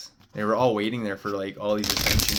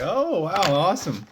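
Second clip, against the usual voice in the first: high, bright-eyed burst of delight, louder; imitating own thrilled reaction to the news; excited; 9 semitones higher, 6 dB louder than usual. Telling the Pleiades beings he is thrilled, excited exclamation.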